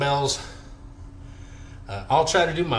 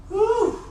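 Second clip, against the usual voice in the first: high whoop. Real, not acted. Psyching up before set.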